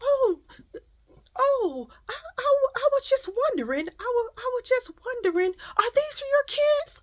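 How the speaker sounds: very high, hesitant stammering voice; mock her in a high, halting voice; imitated nosy woman. Nosy shopper asking a mother about her kids, imitated hesitantly. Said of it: "She's like".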